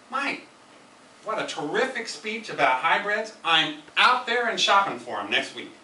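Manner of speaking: big bang ending. Shown as a demonstration. Evaluator closing with excited praise for Mike. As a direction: end with a bang of delight. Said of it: "when you're concluding, you might say".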